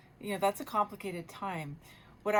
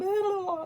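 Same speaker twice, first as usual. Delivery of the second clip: high, loud, wailing blah; hammed-up crying blah; mock wailing blah; 12 semitones higher, 8 dB louder than usual. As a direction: wail it like a child letting go. Mimicking a child's crying release.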